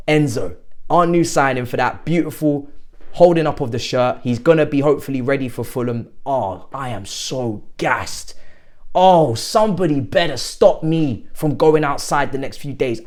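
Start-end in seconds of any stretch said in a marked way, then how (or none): none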